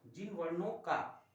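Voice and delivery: slow, word-by-word dictation of the definition; teacher dictating a definition slowly, each word spaced out. Shown as a demonstration. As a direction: spell it out slowly so students can write. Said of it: "एक बार फिर repeat कर रहा हूँ".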